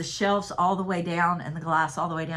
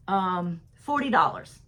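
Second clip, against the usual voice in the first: ordinary talk, nothing marked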